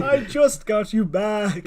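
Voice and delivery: loud, high, drawn-out mock wail; over-the-top anguish. Father crying out to his lost daughter, melodramatic wail. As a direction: stretch it out in hammed-up despair. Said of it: "do it over the top".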